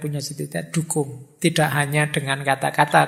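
ordinary talk

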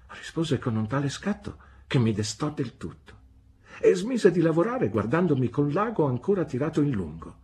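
usual speaking voice

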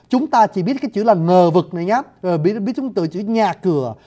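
ordinary talk

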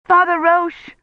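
high, loud call for help; trapped woman desperately calling the priest; desperate cry. A narrator's reading. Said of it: "she cried desperately".

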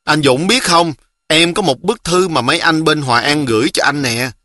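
ordinary delivery

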